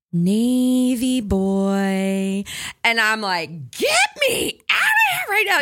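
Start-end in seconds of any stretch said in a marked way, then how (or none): 0.1-2.4 slow, drawn-out creepy whisper. Retold or imitated. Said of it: "we hear". 3.7-5.6 high, laughing panic; panicked. Retold or imitated. Panicked escape retold.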